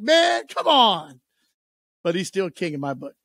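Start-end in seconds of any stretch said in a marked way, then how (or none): 0.0-1.2 high, loud, very slow drawn-out complaint; exasperated groan. Retold or imitated. Telling how Kenny King felt, a slow loud exasperated cry. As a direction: stretch it out in mock despair, loud and high.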